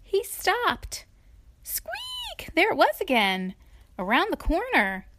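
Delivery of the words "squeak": soft squeaked word, extremely high; tiny squeak. A narrator's reading. Trapped Tiny heard across the farmyard, tiny squeak. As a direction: make it a wee, high squeak. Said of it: "he heard a very tiny".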